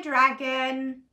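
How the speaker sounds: ordinary delivery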